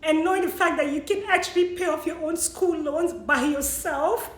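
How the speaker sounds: ordinary talk, nothing marked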